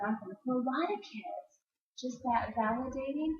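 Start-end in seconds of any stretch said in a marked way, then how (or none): none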